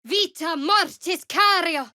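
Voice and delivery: high, slow chanted spell; slow high casting chant; incantation. Acted. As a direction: intone the spell slowly, high.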